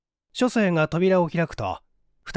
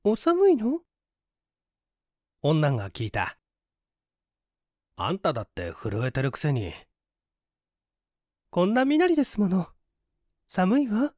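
put-on high, light voice of a young woman; young woman's voice; 4 semitones higher than usual. A narrator's reading. A young woman asks her companion if he's cold, high voice.

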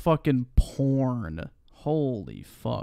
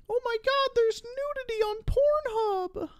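far higher, squealing fake outrage; mock shocked prude. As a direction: parody a clutching-pearls scold. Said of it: "That's like saying".

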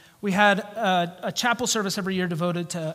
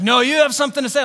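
loud, high, rapid challenge; pushy drunk cutting in loudly; mock drunk insistence; 6 semitones higher, 8 dB louder, much faster than usual. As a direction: imitate an insistent drunk, raised and fast. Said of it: "he's still drunk".

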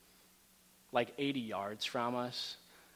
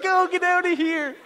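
much higher, much louder squeaky cheer; cheering marmots; 12 dB louder than usual. Pretend. Marmots cheering the hikers down the slope, squeaky and loud. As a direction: voice the marmots egging them off the mountain. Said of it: "They were like".

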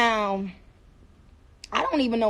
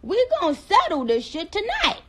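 higher-pitched playful ultimatum; mock showdown; 7 semitones higher than usual. Mock showdown over best accents.

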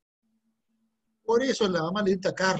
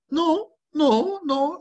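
mock high no; 12 semitones higher, 4 dB louder, much slower than usual. Teacher mocking romantic idea.

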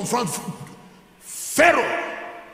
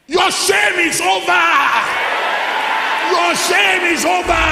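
very loud, much higher cry; full-force preaching shout; 11 semitones higher, 10 dB louder than usual. Real, not acted. Preacher proclaiming to congregation, shouting high and loud. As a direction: hammer it home three times.

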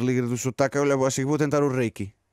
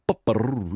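pigeon coo with a rolled Spanish r; comic trilled pigeon call; Spanish-sounding pigeon coo; 2 semitones lower, much slower than usual. Acted. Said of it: "este sonido de pombo fica espanholado".

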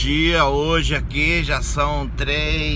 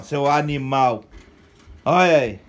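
ordinary talk

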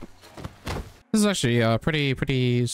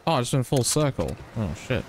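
ordinary delivery

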